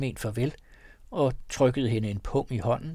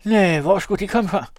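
higher, louder feigned denial; calling out; 8 semitones higher, 8 dB louder than usual. A narrator's reading. Person inside denying the strangers, loud. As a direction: deny it with mock innocence.

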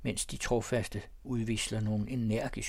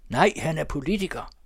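higher, louder chairman's call; big, raised announcing voice; stentorian shout; 4 semitones higher, 5 dB louder than usual. A narrator's reading. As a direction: call it over a noisy hall. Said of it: "råber dirigenten med stentor røst".

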